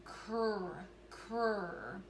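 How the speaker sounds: "Cr" sounded out slowly; teacher sounding out; stretched sounds. Shown as a demonstration. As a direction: stretch the blend "Cr" for spelling. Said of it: "So let's stretch it out".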